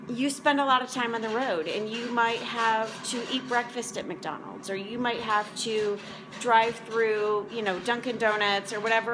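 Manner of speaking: usual speaking voice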